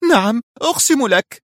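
terrified high-pitched scream of a plea. A narrator's reading. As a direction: swear it in a high, desperate shout. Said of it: "صرخت".

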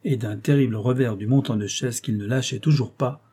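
plain reading voice

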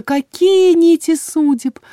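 high, loud, drawn-out wonder; enraptured. A narrator's reading. Healer marvels at threads of fate, enraptured lilt. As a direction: swoon over it in a slow singsong.

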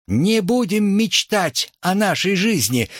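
high-pitched slogan cry. A narrator's reading. Nicole calling a slogan to a noisy student audience, crying out. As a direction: call it out over a crowd. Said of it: "выкрикнула Николь".

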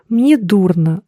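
plain reading voice

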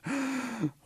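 sharp audible intake of breath; long dramatic gasp; shocked gasp. A narrator's reading. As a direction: gasp in horror at what was done. Chief looks down in shock.

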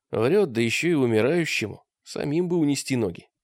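usual speaking voice

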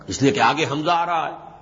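ordinary talk

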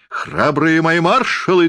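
high, slowed commanding cry; Napoleon. A narrator's reading. Stage Napoleon rallies his marshals with a theatrical cry. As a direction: call the troops grandly, pitched well up. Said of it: "крикнул Наполеон".

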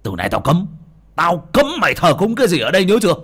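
loud, fast angry order; father threatening his son; stern threat. A narrator's reading. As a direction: lay down the law.

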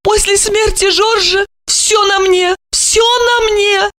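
high theatrical complaint; complaining. A narrator's reading. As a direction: whine it like a spoiled widow. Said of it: "пожаловалась она".

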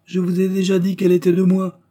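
high, loud insistent reply; impatient retort. A narrator's reading. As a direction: cut in, insisting on the point. Impatient retort to her accusation.

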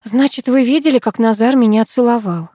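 ordinary delivery

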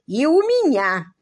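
slow, high, loud comeback; parry it emphatically; retort. A narrator's reading. Earl answers his sister with a loud, drawn-out retort. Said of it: "парировал граф".

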